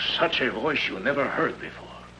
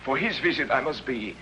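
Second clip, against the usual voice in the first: ordinary delivery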